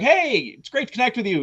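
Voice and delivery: sweet as pie before the sting; fake cheerful greeting. Fake-friendly opener to rude caller.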